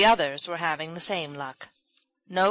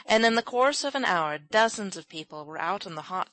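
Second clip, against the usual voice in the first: usual speaking voice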